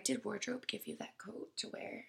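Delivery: whispered, hushed question. Retold or imitated. Strangers asking about the coat, whispered. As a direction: breathe it like a secret.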